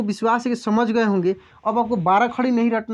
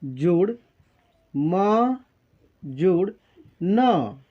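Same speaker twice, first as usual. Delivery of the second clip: breaking into syllables; 3 semitones lower, much slower than usual. Shown as a demonstration. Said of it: "तो कैसे तोड़ेंगे?".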